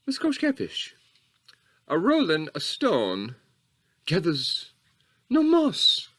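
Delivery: high, slow imitation of a lady; put-on high ladylike voice, drawn out; lady's voice. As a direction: go up there for a lady reciting a proverb. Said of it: "I had to go up there 'cause there's a lady's voice".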